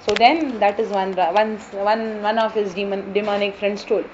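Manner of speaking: usual speaking voice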